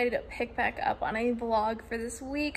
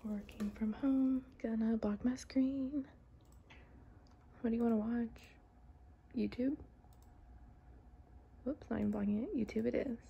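whispering; 11 dB quieter than usual. Real, not acted.

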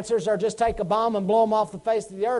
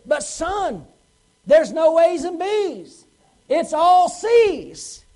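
slow, louder, each word pressed home; emphatic dad; 5 semitones higher, 4 dB louder, much slower than usual. Acted. Dad pointing out to his son there are no A's, slow and emphatic. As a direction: spell it out slowly for the son.

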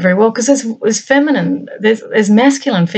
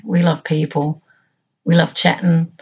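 ordinary talk

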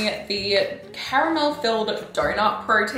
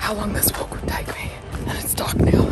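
whispering. Real, not acted. Lost on a trail at dusk.

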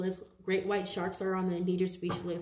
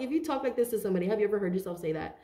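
ordinary delivery

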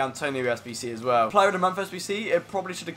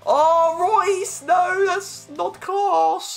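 loud, high cry of disbelief; excited shout; 5 dB louder, much slower than usual. Real, not acted. Seeing the pull, he shrieks high and loud, slow. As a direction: scream it, can't believe the card.

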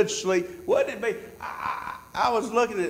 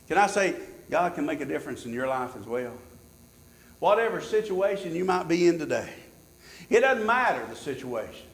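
normal talking voice

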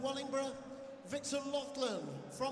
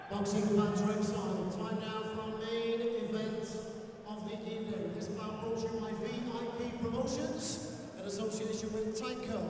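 ring announcer; 2 semitones lower than usual. Real, not acted. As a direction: call the crowd, then rattle off the sponsor.

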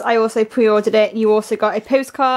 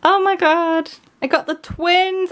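excited squeal; 8 semitones higher than usual. Real, not acted. Thrilled at the photo card pull.

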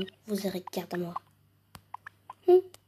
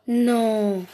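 gasp, then cry out in shock; gasp of surprise; 7 dB louder than usual. Pretend. Sees the last gift.

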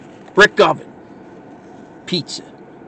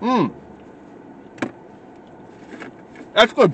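chewing while talking; speaking between bites; mouth full; 4 semitones higher, 7 dB quieter than usual. Real, not acted. Seen 3: Bites slice before; cheeks full, chewing through 3.5s, then napkin wipe.

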